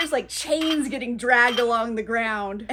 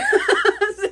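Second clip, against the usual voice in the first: short high loud laugh; bright laugh; 9 semitones higher, 7 dB louder than usual. Real, not acted. She laughs at her own retelling, real high laugh. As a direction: laugh at the story.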